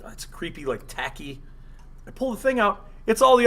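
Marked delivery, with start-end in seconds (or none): 0.0-1.4 whispered under the breath; hushed inner voice; whispered thought. Retold or imitated. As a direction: mutter it to yourself, puzzled. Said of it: "I'm like".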